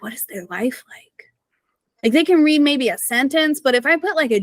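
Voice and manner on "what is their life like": hushed, wondering whisper. Retold or imitated. Guest wonders aloud about her family, in a whisper. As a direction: whisper it to yourself in disbelief. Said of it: "I'm like".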